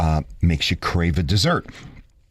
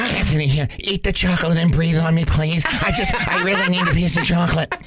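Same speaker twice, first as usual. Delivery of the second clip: a higher, mock pleading wife voice; mimicking wife; 9 semitones higher than usual. Husband imitates his wife begging for chocolate breath. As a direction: beg sweetly like her, half teasing.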